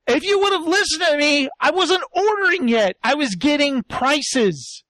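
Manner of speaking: imitated exasperation, slowing at the end; mock exasperated scolding. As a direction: scold them like an unheeded chef, played up. Chef's acted scolding afterwards.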